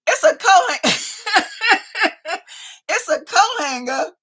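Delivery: laughing through the words. Real, not acted. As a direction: crack up mid sentence. Laughing at exercise bike joke.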